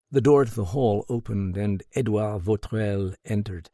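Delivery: ordinary delivery, nothing marked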